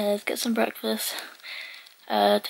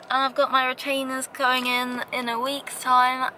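talking with finger at mouth; smiling, finger touching teeth; 2 semitones higher than usual. Real, not acted. Seen 1: Finger touches front teeth/lip through most frames; briefly lifted near 1.1.

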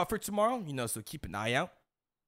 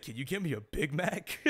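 laughing through it; 4 semitones higher than usual. Retold or imitated. Quoting dad while laughing.

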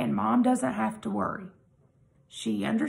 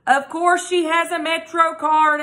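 exasperated; 7 semitones higher, 6 dB louder than usual. Acted. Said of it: "fully exasperated".

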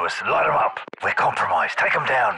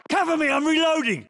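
shouted callout; 7 semitones higher than usual. Acted. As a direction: yell it over the noise. Shouted take.

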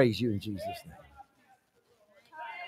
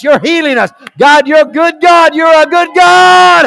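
high, full-voice revival shouting; shouted praise; 9 semitones higher, 11 dB louder than usual. Real, not acted. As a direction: shout praise aloud to God. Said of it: "Come on, shout aloud".

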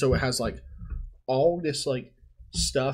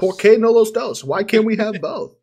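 much higher and louder Spanish catchphrase; excited shout; 8 semitones higher, 9 dB louder than usual. Retold or imitated. Guest recalling the dinner, shouting the catchphrase loud and high. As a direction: belt out the meme line.

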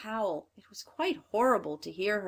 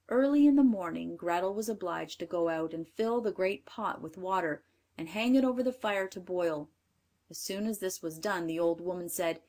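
plain reading voice

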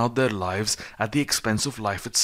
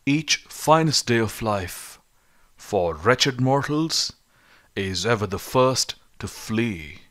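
slow poetic quote; 2 semitones higher, slower than usual. Retold or imitated. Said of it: "Hear the cry of the greatest of poets".

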